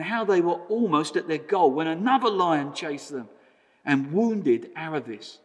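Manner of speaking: steady storyteller reading a book aloud; story narration. A narrator's reading. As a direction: read it like a story to the congregation. Reads a Narnia story aloud.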